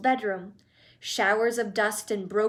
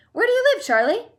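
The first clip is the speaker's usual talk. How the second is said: much higher and louder, thrilled; eager squeal; 12 semitones higher, 8 dB louder, faster than usual. A narrator's reading. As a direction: fling the question out with glee. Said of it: "Wonka eager question".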